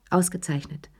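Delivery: ordinary delivery, nothing marked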